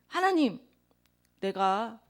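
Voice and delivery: ordinary talk, nothing marked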